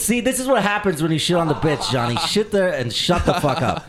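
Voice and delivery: half-joking angry scolding; angry rant. Real, not acted. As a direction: keep scolding him mid-bit. Still ranting at Johnny.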